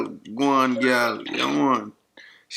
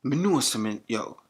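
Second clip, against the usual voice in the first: louder Jamaican patois; Jamaican accent; 3 semitones higher, 6 dB louder than usual. Acted. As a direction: scold her in Jamaican patois. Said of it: "used my Jamaican accent".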